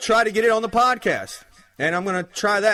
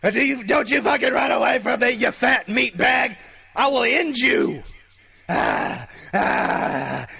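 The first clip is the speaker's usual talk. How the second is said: hammed-up raging zombie, shouting and higher; zombie rant; 4 semitones higher than usual. Host acting out the zombie woman's tirade at employees, hammed-up shouting. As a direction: scream it like a rabid zombie threatening the staff. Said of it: "that's about as good as my zombie gets".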